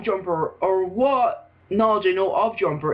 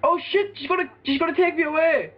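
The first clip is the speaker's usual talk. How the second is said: mock panic; 9 semitones higher than usual. Mock panic at game scene.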